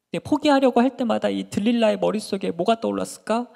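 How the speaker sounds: normal talking voice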